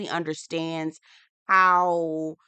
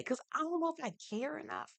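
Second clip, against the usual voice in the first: quiet aside; 10 semitones higher, 10 dB quieter than usual. Real, not acted. Aside about caring.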